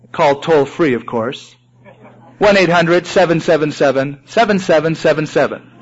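normal talking voice

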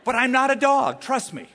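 high, loud protest; loud insistence. Real, not acted. Pastor protests to the congregation, loud and high. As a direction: shout that you're not a dog. Punchline after bark story.

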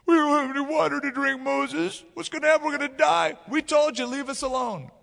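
high-pitched mock whine; whining. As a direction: whine like a hammed-up complainer. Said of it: "they start whining again".

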